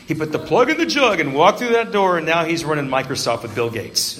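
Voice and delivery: mock-heroic telling; mock triumphant. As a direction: parody the big miracle tale. Mocking the redemption story.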